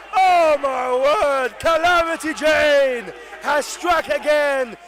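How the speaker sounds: loud, high eruption at a sudden wicket; astonished live sports call at full volume; frenzied commentator's shout. Real, not acted. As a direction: roar it out, stretching the big words.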